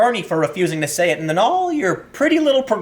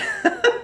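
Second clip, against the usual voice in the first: short laugh. Real, not acted. Amused by an old article.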